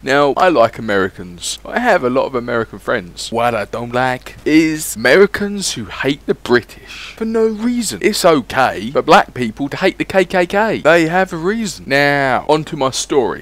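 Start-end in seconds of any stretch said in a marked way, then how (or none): none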